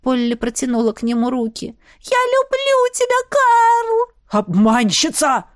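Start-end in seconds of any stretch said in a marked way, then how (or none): 2.0-4.4 high, drawn-out wail; desperate love plea. A narrator's reading. Maid pleads love to departing baron. 4.4-5.5 slow, stretched-out accusing cry; shouted accusation. A narrator's reading. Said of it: "крикнул барон снова".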